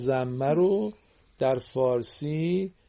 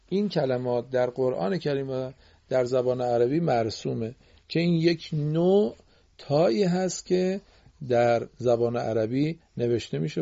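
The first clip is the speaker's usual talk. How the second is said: normal talking voice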